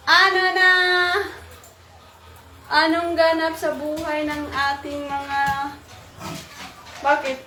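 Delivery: loud, high greeting call. Real, not acted. Calling out to her young ones.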